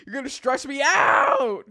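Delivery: slow, loud laughing protest; laughing shout. Real, not acted. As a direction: laugh and yell it at chat. Laughing outburst at chat.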